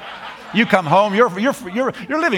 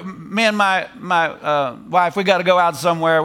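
normal talking voice

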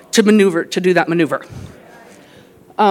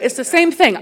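ordinary talk

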